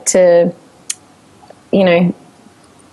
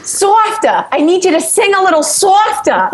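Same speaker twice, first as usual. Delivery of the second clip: high, exaggerated Long Island studio guy; Long Island accent; 10 semitones higher than usual. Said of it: "And he's like".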